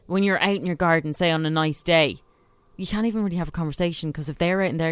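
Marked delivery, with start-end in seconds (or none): none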